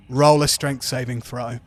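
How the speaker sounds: ordinary talk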